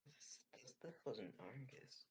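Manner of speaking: very quiet, half-whispered and slow; hushed in-character realisation, barely voiced. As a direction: whisper it slowly, almost to yourself. In character, reporting on investigation.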